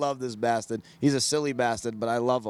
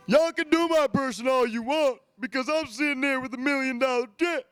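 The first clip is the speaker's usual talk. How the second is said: imitating Mike; 4 semitones higher than usual. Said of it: "Can you do an impersonation of Mike winning".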